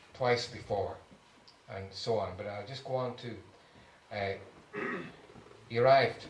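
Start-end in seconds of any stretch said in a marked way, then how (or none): none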